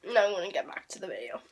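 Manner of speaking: quick whispered aside; fast hushed whisper to the viewers. Real, not acted. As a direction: hurry it out under your breath. Aside to camera mid-snack.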